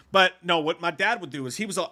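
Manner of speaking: usual speaking voice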